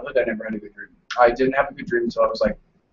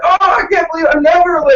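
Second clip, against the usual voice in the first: high, shouted fake horror; mock panic; 14 semitones higher, 7 dB louder than usual. Teasing a punctual friend about nightmares, mock-panicked. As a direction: cry out in exaggerated distress.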